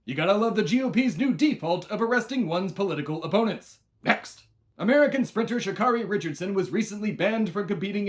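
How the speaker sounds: loud, high rapid-fire news shouting; shouted news. Acted. Host shouting satirical headlines at listeners, loud and high. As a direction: bark the headlines like an over-hyped anchor. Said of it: "I am here to shout it at you even faster".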